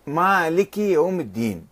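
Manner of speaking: مالك slowly, long a held; مالك with alif. Shown as a demonstration. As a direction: draw out the alif in مالك deliberately. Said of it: "قرأوها بألف". (مالك بألف ممدودة ببطء)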